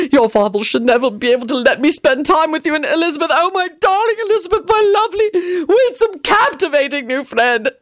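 high, quick, theatrical lament; a fussy gentleman swooning in despair; wailing Tony. A narrator's reading. Tony mourning losing Elizabeth, high theatrical wail.

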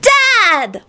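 put-on high-pitched child's cry of joy; joyful shriek. A narrator's reading. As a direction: shriek it with delighted relief. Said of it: "shrieked Teddy joyfully".

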